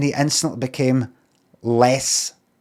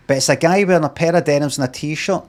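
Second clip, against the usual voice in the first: ordinary talk, nothing marked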